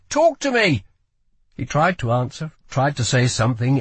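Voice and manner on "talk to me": high, loud urgent demand; frantic, forceful plea. A narrator's reading. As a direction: demand an answer, pitched right up. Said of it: "demanded Lila".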